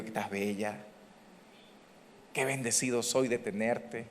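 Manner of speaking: low, gentle lover's murmur; soft in the ear. Acted. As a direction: tenderly praise the spouse, hushed. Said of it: "Le hablo suavecito al oído".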